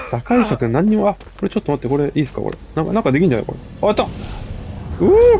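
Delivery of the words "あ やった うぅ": excited cheer. Real, not acted. Player cheering a lucky success in the game, excited whoop. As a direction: whoop it up, pitch leaping high.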